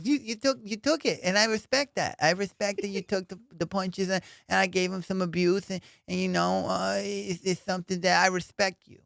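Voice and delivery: imitating Mike Tyson. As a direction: pitch it way up like the champ being gracious. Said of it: "He goes".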